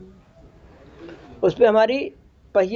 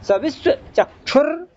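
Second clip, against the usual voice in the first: "सविश्वचक्षुर" slow, higher, rising end; words separated; 4 semitones higher, slower than usual. Shown as a demonstration. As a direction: part विश्व from चक्षुर in "सविश्वचक्षुर". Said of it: "तो अलग-अलग करो उसको". ("सविश्वचक्षुर" धीरे, विश्व और चक्षुर अलग)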